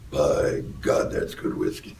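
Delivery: a very low, rumbling bass line with a laugh; pretending the whiskey made his voice bass; deep bass voice. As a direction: sink into a cartoonish bass after the sip. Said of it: "You'll be singing bass tonight".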